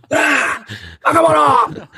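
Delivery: high shouted name in Japanese English. Retold or imitated. Kendo teacher shouting over his student in Japanese English. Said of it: "in his wonderful Japanese English".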